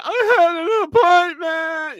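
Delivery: high, laughing mock plea; a hammed-up whiny woman, laughing. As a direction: squeak it out through a laugh, slowly. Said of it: "so the woman stated".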